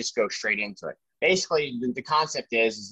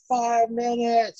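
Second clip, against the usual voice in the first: high, slow, drawn-out call over rotor noise; called-out warning; 12 semitones higher, much slower than usual. Retold or imitated. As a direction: stretch it out like a crew chief's call. Called out on the helicopter.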